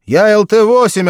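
loud, high radio reply; over a pilot headset. A narrator's reading. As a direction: bark it into the headset. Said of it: "отозвался пилот второй машины".

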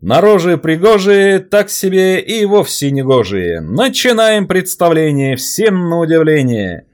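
high, loud, slow fairground patter; loud carnival barker. Acted. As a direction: rhyme it out to the crowd. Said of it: "примеряя на себя роль ярмарочного зазывала".

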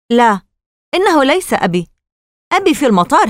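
high, argumentative protest; urgent insistence. Acted. Casey holding his sister by the shoulder, urgent insistence. Brother argues against freeing the man.

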